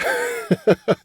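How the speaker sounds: short breathy chuckles; quick run of giggles; amused laugh. Real, not acted. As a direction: chuckle after the tough-guy line. Amused after acting Ben's threat.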